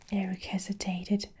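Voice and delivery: ordinary delivery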